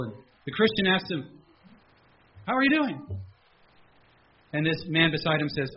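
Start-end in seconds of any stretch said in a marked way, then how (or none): 2.4-3.0 high cheerful question; bright friendly greeting. Retold or imitated.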